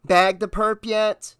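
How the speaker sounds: slow, high, sarcastic drawl; infinite sarcasm. A narrator's reading. Dispatcher asking the rattled officer about his chase, sarcastic. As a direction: needle him, drawing the words out. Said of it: "she asked with infinite sarcasm".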